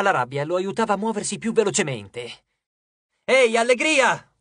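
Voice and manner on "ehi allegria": high, loud, drawn-out call; shouted taunt. A narrator's reading. Percy calls out to distract the goddess, shouting. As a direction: shout to grab attention. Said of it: "urlò".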